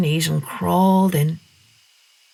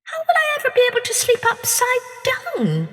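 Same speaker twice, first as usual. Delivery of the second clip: put-on much higher child's voice; wondering girl. A narrator's reading. Masha worrying about sleeping upside down, high. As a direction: wonder aloud as a little girl. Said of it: "she wondered".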